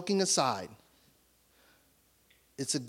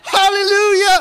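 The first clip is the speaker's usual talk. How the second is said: much louder and much higher; jubilant shout; 12 dB louder than usual. Real, not acted. Pastor rejoicing before the congregation, shouting with joy. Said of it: "I'm not joking".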